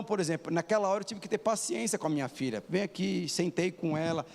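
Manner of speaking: ordinary delivery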